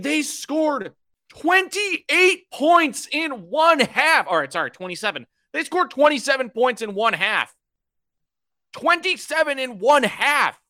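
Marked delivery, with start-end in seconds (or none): 0.0-4.3 high, loud, slow disbelief; exasperated outburst. Real, not acted. Outraged at the Bears collapse. 5.5-10.6 emphatic disbelief. Real, not acted. Hammering home the score.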